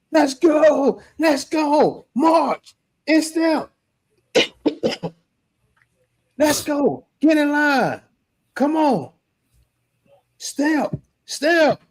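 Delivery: high yelled marching orders; retold yelled commands. Leaders yelling at the new members to line up, high yelled orders. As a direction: bark out the drill call like a drill leader. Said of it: "everybody coming out yelling".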